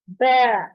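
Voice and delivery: बै said with lips spread as in a smile; Arabic ba, smiling lips. Shown as a demonstration. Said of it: "इसको बै पढ़ेंगे, बा नहीं पढ़ेंगे".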